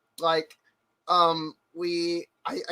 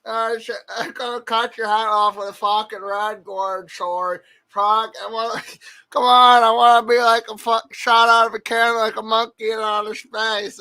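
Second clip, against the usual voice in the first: loud, pitched-up Brett ranting; Brett impression; 5 semitones higher, 7 dB louder than usual. Friend mimics Brett's game hype, loud and high. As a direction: blast it like an overexcited gamer. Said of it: "That's exactly how he fucking talks".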